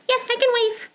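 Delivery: high puppet voice; Trudy puppet voice. Acted. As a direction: answer brightly as the puppet.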